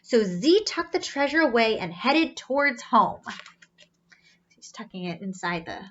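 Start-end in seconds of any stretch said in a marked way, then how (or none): 4.6-5.8 hushed aside in a breathy undertone; whisper. Real, not acted. Teacher whispering to children about the picture.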